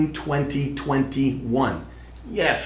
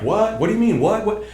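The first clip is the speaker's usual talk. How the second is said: high-pitched baffled viewer reacting; mock disbelief; 10 semitones higher, 4 dB louder than usual. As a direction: fire off startled, incredulous questions.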